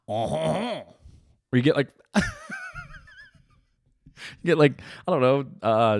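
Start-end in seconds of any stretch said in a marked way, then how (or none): 0.1-1.0 mock French laugh. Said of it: "I'm talking like one of them Paris motherfuckers".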